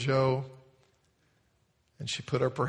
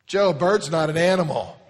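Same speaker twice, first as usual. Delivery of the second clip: put-on playful little girl; 4 semitones higher, 8 dB louder than usual. Girl correcting Joe.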